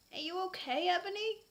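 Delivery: a mock-tender Draco asking; gently asked. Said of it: "Draco asked me gently".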